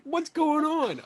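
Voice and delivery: high, drawn-out plaintive question; mock bewilderment. Acts bewilderment at being ignored.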